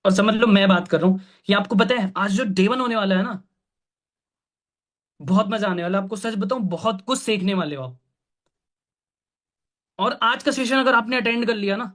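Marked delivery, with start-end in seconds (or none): 1.5-11.9 lively, lilting pitch that builds anticipation; showing excitement. Shown as a demonstration. Mentor demonstrating an enthusiastic session invite to team, excited. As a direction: pitch it eagerly, like a promise of fun. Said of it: "मैंने इसमें excitement दिखाई".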